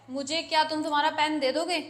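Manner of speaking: ordinary delivery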